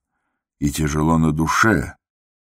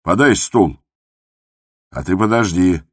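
louder, higher brusque command; curt raised-voice orders; shouted order; 4 semitones higher, 5 dB louder than usual. A narrator's reading. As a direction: call out sharply to underlings. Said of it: "крикнул он так громко".

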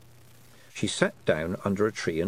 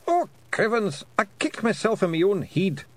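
a much higher little Scots Feegle, yelping; 11 semitones higher, 3 dB louder than usual. Acted. Feegle to the others on the road, a high little yelp. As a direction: squeak it out like a tiny rowdy Scot.

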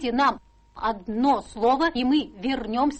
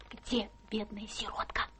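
whispered, sentimental letter line; soft whispered reading of a tender letter; hushed reading; 3 semitones lower, 7 dB quieter than usual. Acted. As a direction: breathe it out like a secret.

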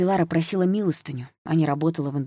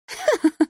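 short high, loud spiteful laugh; brief high-pitched scornful cackle; mirthless angry laugh; 5 dB louder than usual. A narrator's reading. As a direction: laugh bitterly, without any joy. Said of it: "смех у неё был не весёлый, скорее злой".

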